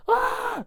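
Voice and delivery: loud, high comic scream; mock scream right back; answering scream. Grinch answering Cindy's scream, loud mock yell. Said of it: "And the Grinch is like".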